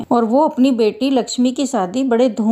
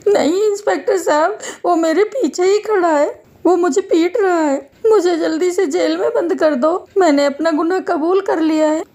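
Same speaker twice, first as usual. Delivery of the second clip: high, whining terrified voice; panicked begging; 7 semitones higher than usual. Acted. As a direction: plead desperately to be locked up. Thief begs to be jailed.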